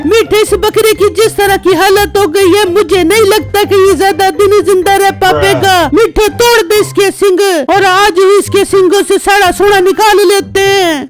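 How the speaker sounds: wife's voice. Acted. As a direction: play the scheming wife cooing at her husband. Wife urges breaking horns. (पत्नी की ऊँची, मीठी आवाज़)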